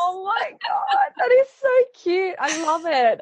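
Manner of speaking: very high, drawn-out coo; gushing delight. Real, not acted. Host hears the little boy's breathing story, gushing. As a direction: melt over it in a high, slow squeal.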